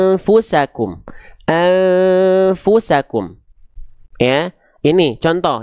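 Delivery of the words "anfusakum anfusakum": "Anfusakum" with a long masked hum gliding into f; slow chanted "Anfusakum", no hard ng; concealed n before f. Shown as a demonstration. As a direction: hold the "An" as a sustained hum ready for "fu". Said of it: "Bersiap-siap melafalkan fa-nya".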